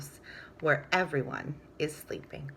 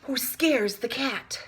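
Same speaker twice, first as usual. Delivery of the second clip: higher, slow, dramatic questions; rising excitement; 8 semitones higher, slower than usual. A narrator's reading. Reading to children, slow dramatic excited questions. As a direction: build the chain reaction with drama.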